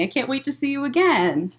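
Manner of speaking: ordinary delivery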